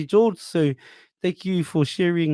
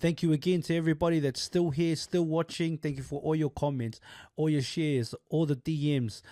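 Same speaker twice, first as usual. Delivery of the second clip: soft, even sign-off read; outro read; 7 dB quieter than usual. Acted. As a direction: thank the viewers gently. Outro thanks read to viewers.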